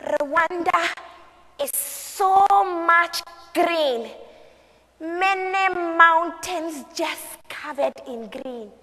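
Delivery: high, slow, drawn-out wonder; admiring mother. Acted. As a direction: linger lovingly over each phrase of the view. Mother proudly shows view of Rwanda.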